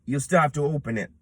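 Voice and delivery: ordinary talk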